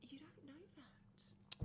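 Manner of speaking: slow, breathy whispered doubt; slow teasing whisper. Real, not acted. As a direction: hush it out slowly like a secret. Teasing disbelief at a family claim.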